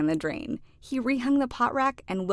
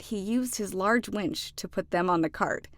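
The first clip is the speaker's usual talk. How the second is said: usual speaking voice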